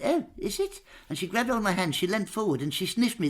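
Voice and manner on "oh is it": much higher, pert royal query; the Queen intrigued by the smell; high surprised Queen. Retold or imitated. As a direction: chirp "Oh, is it?" way up. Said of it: "And she went".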